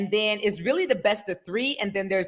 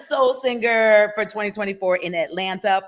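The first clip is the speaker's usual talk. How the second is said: ordinary delivery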